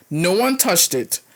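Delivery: ordinary delivery, nothing marked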